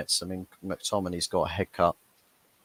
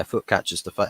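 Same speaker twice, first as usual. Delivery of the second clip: ordinary talk, nothing marked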